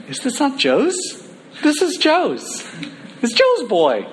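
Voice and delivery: high, eager crowd voice; excited hometown crowd; proud locals. Acted. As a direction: claim him as your own boy.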